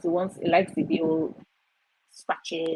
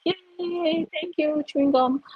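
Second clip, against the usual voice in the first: higher, chirpy thanks; mock cheer; 10 semitones higher, slower than usual. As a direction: cheer for the gum playfully. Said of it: "So I'm like".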